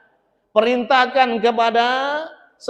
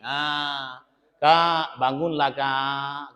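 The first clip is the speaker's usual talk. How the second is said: soft, lower pleading wake-up; gentle coaxing; 6 semitones lower, 3 dB quieter, much slower than usual. Retold or imitated. Wife softly pleading husband to wake. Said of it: "dengan penuh kelembutan".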